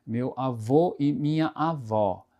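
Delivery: slow "avô" closed O, "avó" open Ó; avô versus avó. Shown as a demonstration. Teacher contrasts grandfather and grandmother, slow. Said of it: "acento circunflexo".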